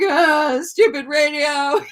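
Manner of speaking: high, loud crying complaint; tearful groan. Retold or imitated. Scolding the radio on the drive, high tearful cry. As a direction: whine at the radio through tears. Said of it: "I was like".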